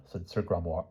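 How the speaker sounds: plain reading voice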